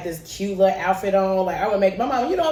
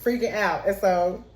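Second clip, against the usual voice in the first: usual speaking voice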